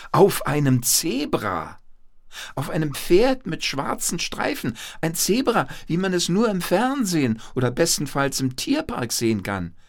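high-pitched, excited storytelling; amazed narration. A narrator's reading. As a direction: build wonder for small listeners. Narrator marvels at the zebra. (staunend und begeistert erzählen)

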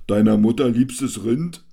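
loud, high mocking heckle; heckler's echo. A narrator's reading. Man before the stage mockingly echoes the jester, loud. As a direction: throw the joke back at the stage. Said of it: "Ein Mann vor der Bühne echot".

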